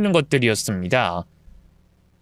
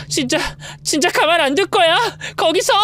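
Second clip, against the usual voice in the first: angry shout; 14 semitones higher than usual. Acted. Wife loses her temper chasing the mistress, shouting. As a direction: yell the threat after her.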